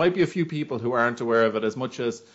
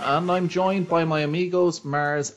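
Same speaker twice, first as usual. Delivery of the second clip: upbeat radio-style welcome; podcast host intro; 4 semitones higher than usual. Acted. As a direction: announce it like a show host.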